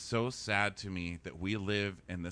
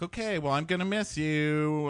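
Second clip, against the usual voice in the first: mock Chase; 5 semitones higher, 3 dB louder than usual. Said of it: "He's like".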